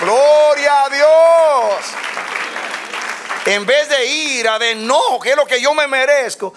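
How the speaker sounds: voz aguda y alzada, lenta y enfática; prédica exaltada. Real, not acted. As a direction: proclama con fervor a plena voz. (prédica fervorosa, en alto)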